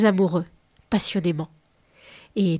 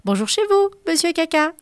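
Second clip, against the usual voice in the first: much higher, louder creature greeting; fish voice; 8 semitones higher, 5 dB louder than usual. Acted. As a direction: squeak it out like a little fish. Fish greet him while fishing.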